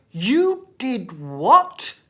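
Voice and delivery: slow, loud horrified disbelief; shocked teacher. Retold or imitated. Teacher hearing the boy's answer, slow shocked disbelief.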